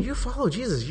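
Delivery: high-pitched accusing schoolgirl; junior high girl. Retold or imitated. Junior high girl accusing Peter again, high-pitched. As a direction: challenge him in a high girl's voice. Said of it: "she does it again".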